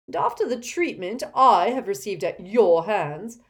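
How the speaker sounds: haughty, emphatic refusal; scornful pompous portrait. Acted. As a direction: lecture them like an affronted headmaster.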